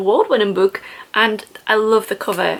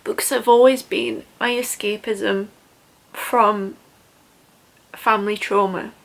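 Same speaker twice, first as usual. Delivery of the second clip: getting emotional. Real, not acted. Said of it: "I don't know why I'm getting so emotional".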